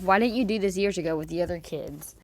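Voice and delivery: usual speaking voice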